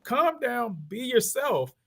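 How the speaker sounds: high, slow reassuring attorney; calm down advice. Retold or imitated. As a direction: stretch it out high, easy and soothing. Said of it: "he's like".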